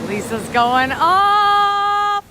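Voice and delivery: high, very slow, stretched-out cheer; drawn-out high hype call; hype shout-out. Real, not acted. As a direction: stretch every word out to hype her up. Hyping a stream gifter.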